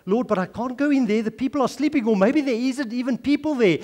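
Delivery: retold prayer, voice lifted in objection; praying out loud. Man protests to the Lord outside a dark house, praying out loud. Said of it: "he was praying out loud now".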